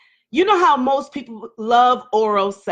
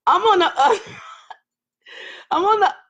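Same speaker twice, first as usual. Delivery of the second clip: laughing; 9 semitones higher, faster than usual. Real, not acted. Flustered, laughing at her own confession.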